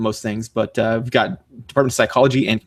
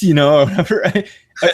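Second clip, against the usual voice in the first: ordinary talk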